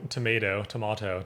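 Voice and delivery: TOMATO first as "to-MAY-to", then "to-MAH-to"; American, then British TOMATO; tomato both ways. Shown as a demonstration. Contrasting the two pronunciations.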